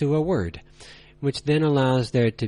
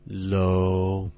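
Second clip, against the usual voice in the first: "low," held deep, lower and flat; low level tone; 5 semitones lower than usual. Shown as a demonstration. Teacher showing learners the third tone, low and flat. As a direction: drop "low," to the bottom and hold it. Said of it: "Yes, it would be".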